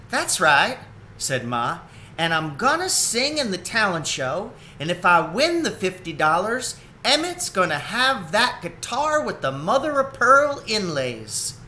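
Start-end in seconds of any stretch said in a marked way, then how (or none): 0.1-0.8 higher, firm mother voice; a lightly voiced determined mother; confident Ma. Ma answers Esther. 2.2-11.5 higher, firm mother voice; a lightly voiced determined mother; confident Ma. Ma explains her plan.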